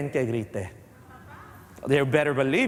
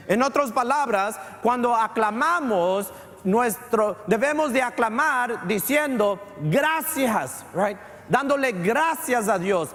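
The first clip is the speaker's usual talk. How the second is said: fervent preaching; 6 semitones higher than usual. Acted. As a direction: proclaim the verse to the congregation. Preaches scripture at full force. (predicación fervorosa, lenta y elevada)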